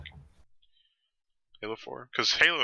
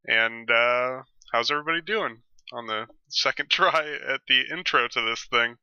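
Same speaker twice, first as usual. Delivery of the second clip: normal talking voice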